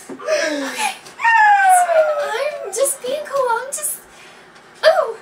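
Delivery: very high, squeaky, drawn-out fluster; flustered squeal. Pretend. Player flustered by his blush, high squeal. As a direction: squeal while insisting you're fine.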